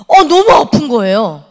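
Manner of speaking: slow, loud, crying out in pain; pained wail. Retold or imitated. 수술 후 깨어나 아파서 울먹이며 크게 외침. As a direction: let the hurt break through the words.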